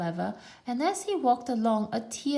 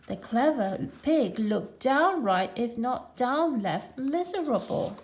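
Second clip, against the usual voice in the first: plain reading voice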